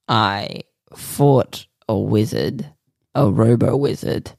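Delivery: ordinary talk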